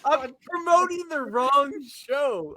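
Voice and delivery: high, slow exasperated wail. Real, not acted. Host realizes he plugged the wrong show, exasperated high wail. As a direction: moan it out at yourself in dismay.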